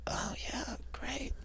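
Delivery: high, hushed, unvoiced; whispered forced politeness; awkward whisper. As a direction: breathe it out uncomfortably. Girl's awkward reaction.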